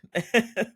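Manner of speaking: a high burst of laughter. Real, not acted. Laughing at the mom's line.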